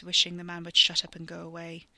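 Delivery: plain reading voice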